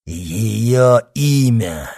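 loud, drawn-out insistence; forceful demand. A narrator's reading. Monk presses the dying man for a name, insistent. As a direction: wrench the name out, slow and loud. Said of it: "повторял монах ... словно желая силой вырвать".

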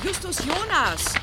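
von weit weg laut nach dem Jungen rufen; aus der Ferne gerufen. Acted. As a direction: ruft quer über den Schrottplatz. Aunt calls for the boy. (Tante Mathilda ruft aus der Ferne nach Justus)

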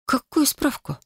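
растерянный вопрос, голос выше; переспросить в полном замешательстве; растерянно. Acted. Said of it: "растерялась я". (растерянно, на высокой ноте)

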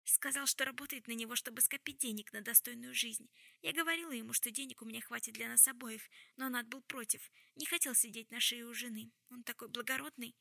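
usual speaking voice

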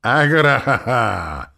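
loud, high, drawn-out demon laugh; nervous demonic cackle. A narrator's reading. Nervous demonic guffaw, acted big. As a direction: demonic laughter bordering on hysteria. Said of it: "зашлась нервным демоническим хохотом".

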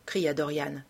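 plain reading voice

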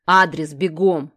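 loud, slow barked command; barked order. A narrator's reading. Kir looming over Sasha, barking loudly for the address. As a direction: bark it at her, demanding. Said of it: "рявкнул я".

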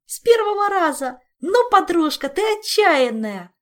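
high, loud delighted cheer; squealing Zin. Acted. Zin marvelling at her friend's daring, delighted squeal. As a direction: shriek with joyful amazement. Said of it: "радостно взвизгнула Зинь".

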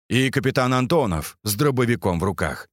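ordinary delivery

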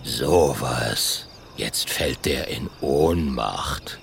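low, slow rumbling grumble; grumbling dinosaur. Acted. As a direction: grumble in surprise, deep.

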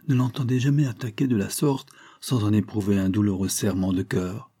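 plain reading voice

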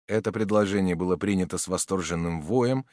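usual speaking voice

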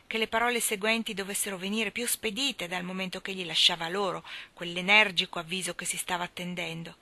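usual speaking voice

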